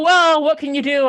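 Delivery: normal talking voice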